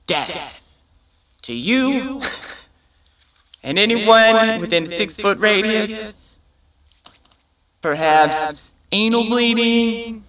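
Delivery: high, bright list of horrors; cheerful ad with eerie menace. Retold or imitated. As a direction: list doom like good news. Ad listing side effects.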